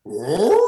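loud, high rising howl; werewolf roar. Shown as a demonstration. Speaker shows the werewolf fist bump, loud howl. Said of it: "you bump and you go".

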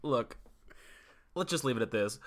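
usual speaking voice

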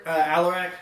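ordinary talk, nothing marked

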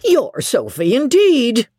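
indignant scoff, rising high on INDEED; mocking servant's retort; scornful cook. A narrator's reading. Drunk cook scoffs at mistress.

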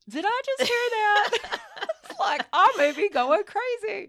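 high, loud and laughing at herself; laughing disbelief. Retold or imitated. Telling a friend she heard the voice, laughing high disbelief. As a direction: laugh as if she can't believe her ears. Hearing the voice a second time.

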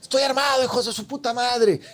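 high-pitched mock threatening yell; retold armed warning. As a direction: re-enact warning off intruders, pitch way up. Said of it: "le corto y le digo".

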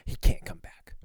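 imitated forceful, angry stage whisper. Teacher banning the child after third time, forceful angry whisper. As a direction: whisper it harder, as a final ban. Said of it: "whispering to my mom in a very angry voice".

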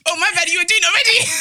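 loud laughing exclaim. Real, not acted.

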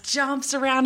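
ordinary delivery

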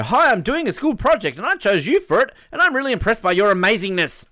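high, loud, excited fan voice; gushing message read-out. Acted. Host reading a pupil's school project message, gushing. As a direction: read it with over-keen, swooping enthusiasm.

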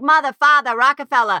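put-on dropped R's, loud Boston accent. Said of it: "people like".

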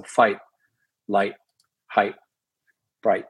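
"fight, light, height, bright" ending in a glottal stop; American glottal T. Shown as a demonstration. Actor shows the American glottal stop in "fight, light, height, bright". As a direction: cut off each final T with a glottal stop. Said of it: "glottal stop on that".

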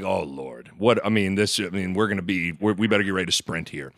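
low, soft panicked mutter; worried mutter. Retold or imitated. Husband fears trouble in Dublin pub, low worried mutter. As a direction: mutter under your breath, bracing to run. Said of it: "And I'm like".

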